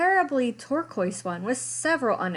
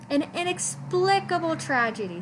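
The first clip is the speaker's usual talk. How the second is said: high, fake-solemn innocence; mock innocence; 8 semitones higher than usual. A narrator's reading. Lester feigning sorrow for the shredded sweater, mock-solemn. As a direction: play dumb over the wreck. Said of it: "said Lester".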